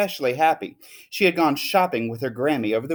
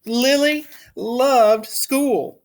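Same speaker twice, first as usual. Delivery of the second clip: storybook narration; 9 semitones higher, much slower than usual. A narrator's reading. Story opening, slow high read.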